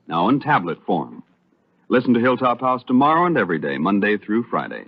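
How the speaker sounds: ordinary talk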